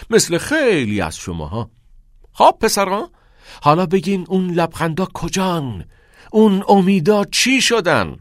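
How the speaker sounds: rousing classroom speech; impassioned teacher. Acted. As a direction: press the boys with pointed questions.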